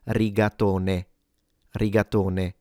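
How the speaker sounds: "rigatone" ending in an Italian e, as in "neh"; Italian singular "rigatone" with final e sounded. Shown as a demonstration. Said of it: "spelled with an English E".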